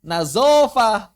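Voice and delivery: loud, drawn-out "Nazofah" with buzzing z; mock the wrong reading "Nazofah", slowly and loudly; "Nazofah" with plain z in place of dh; mispronounced dh as z. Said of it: "yang baca nadhafah jadi apa?".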